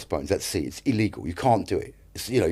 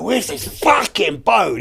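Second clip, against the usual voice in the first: high, drawn-out retold exasperation; exasperated; 10 semitones higher, much slower than usual. Waiting in Cannes for the late boat, high mock exasperation. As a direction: wail it in mock frustration, stretching the swearword.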